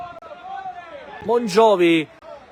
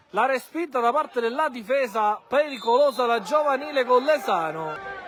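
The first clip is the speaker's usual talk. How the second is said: normal talking voice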